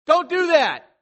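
urgent high, loud shout; alarmed parent's full-voiced warning; parent yelling. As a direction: yell to stop a child running into traffic. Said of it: "you yell at them".

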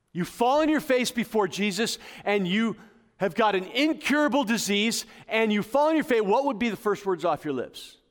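full-force pulpit preaching about God's care; impassioned preaching. Acted. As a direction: preach it with rising fire. Preaching, questioning congregation.

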